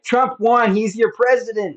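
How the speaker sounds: high, loud triumphant holler; imitated screaming. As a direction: mimic a buddy yelling to the whole town.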